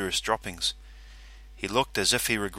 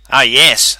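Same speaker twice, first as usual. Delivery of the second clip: Calverley character voice; 3 semitones higher, 14 dB louder than usual. Acted.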